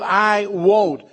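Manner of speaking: stubborn refusal. Retold or imitated. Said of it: "That's exactly the attitude".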